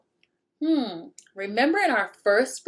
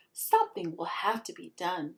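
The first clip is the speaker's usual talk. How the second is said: conspiratorial hush; 3 semitones lower, 6 dB quieter than usual. Acted. Ringleaders plotting in secret.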